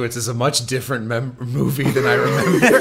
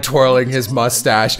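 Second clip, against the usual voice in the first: ordinary talk, nothing marked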